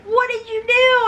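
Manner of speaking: high, mock-alarmed friend voice; startled friend. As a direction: squeak it like the shocked friend. Friend's startled question retold.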